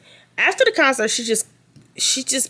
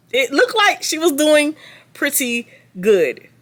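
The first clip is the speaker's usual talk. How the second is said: slow sarcastic drawl; 7 semitones higher, slower than usual. Acted. Sarcastic repeat, drawn out.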